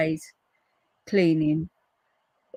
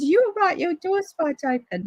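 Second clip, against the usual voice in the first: much higher concerned neighbour voice; 10 semitones higher than usual. Retold or imitated. Neighbour asking her at the open door, concerned. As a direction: voice the worried neighbour. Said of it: "he said".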